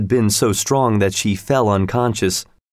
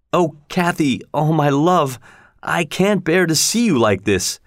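higher-pitched, mildly anguished lament; anguished lover; 6 semitones higher than usual. A narrator's reading. Lover to his dying beloved, in anguish. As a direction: plead with pained tenderness, pitch lifted. Lover sees her deathly sick.

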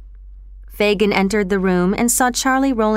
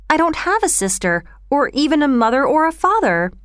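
put-on higher, pleading boy's voice; alarmed; 5 semitones higher than usual. A narrator's reading. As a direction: insist anxiously as a frightened boy. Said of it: "Oliver replied, greatly alarmed".

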